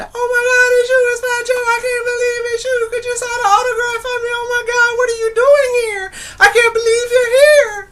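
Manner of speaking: very high, loud falsetto gushing; screaming fangirls. Retold or imitated. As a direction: imitate a pack of fans shrieking at a star. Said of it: "They was screaming".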